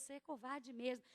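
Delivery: a deep, hushed rebuke; God's voice. Retold or imitated. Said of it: "Aí Deus".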